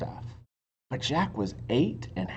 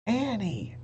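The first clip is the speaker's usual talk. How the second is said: Jack calling; 8 semitones higher than usual. A narrator's reading. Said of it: "Jack called".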